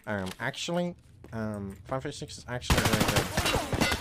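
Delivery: slow, nasal-sounding mock know-it-all; slow mock nerd; nerdy um-actually voice. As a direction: do a pedantic nerd correcting chat.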